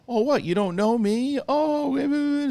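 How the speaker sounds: high, hammed-up melodramatic gasp; mock silly scene. Reviewer mocks a cheesy scene he feared, in a high hammy voice. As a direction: parody a cheesy soap reunion line. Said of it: "some silly scene".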